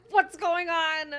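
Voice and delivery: loud, high, drawn-out shout; alarmed call. Acted. Game master voicing Soul busting in at the gates, calling out.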